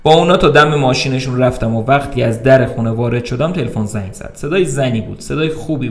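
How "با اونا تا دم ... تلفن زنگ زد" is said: ordinary delivery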